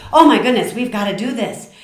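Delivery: high, loud burst of enthusiasm; excited, passionate. Retold or imitated. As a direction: blurt it out with urgent passion. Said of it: "I just am excited, I'm passionate".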